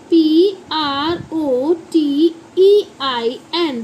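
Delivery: slow letter-by-letter spelling; measured classroom spelling. Shown as a demonstration. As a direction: spell it out one drawn letter at a time. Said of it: "तो इसे हम बोलते हैं".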